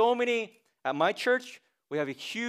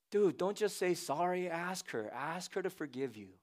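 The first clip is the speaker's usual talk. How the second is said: quiet advice; 10 dB quieter than usual. Retold or imitated. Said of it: "I'm gonna tell him".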